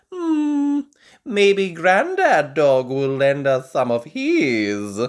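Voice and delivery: high, loud, slow musing; suggesting granny. A narrator's reading. Granny Pig suggests borrowing tomatoes, slow and musing. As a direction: muse on an idea. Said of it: "suggests Granny Pig".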